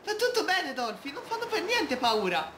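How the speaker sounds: a character comforts Dolphy in a high cheerful voice; reassuring character voice. Acted. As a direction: soothe a scared friend in a high cartoon voice.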